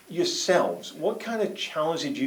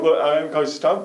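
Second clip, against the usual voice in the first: normal talking voice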